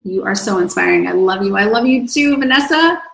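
ordinary delivery

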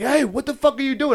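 high-pitched mock confrontation; alarmed challenge. Police catching traveler back inside the terminal, mock-outraged challenge. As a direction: challenge him sharply, pitched well up.

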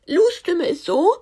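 high, loud put-on king voice; squeaky silly king voice; Lou voice. Shown as a demonstration. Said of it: "Lous Stimme ist übelst cringe".

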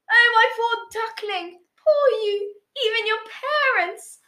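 high, fussing, pitying voice; exclaiming aunt. A narrator's reading. Aunt pities her favourite nephew, high exclaiming voice. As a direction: coo in exaggerated high-pitched sympathy. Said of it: "their aunt exclaimed".

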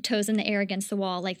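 ordinary talk

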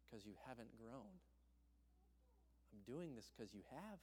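soft, hushed, reassuring voice of God; gentle whisper. Retold or imitated. The Spirit speaking to a discouraged student, soft and hushed. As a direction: speak it like a tender secret. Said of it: "whispering to me so clearly".

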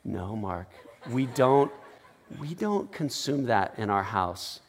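lightly voiced wife, low and soft; hushed mock reproof with pauses; imagined wife's voice. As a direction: quietly correct him, as an imagined wife. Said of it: "I hear my wife's voice".

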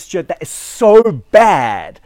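retold doctor's telling-off; scolding doctor. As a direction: scold like a dismayed doctor, leaning on SO BAD. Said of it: "now I'm your doctor".

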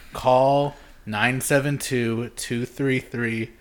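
slow, deliberate phone number; slow number read. Shown as a demonstration. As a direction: spell the number out slowly. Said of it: "reading out hotline number".

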